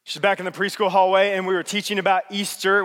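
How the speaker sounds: ordinary talk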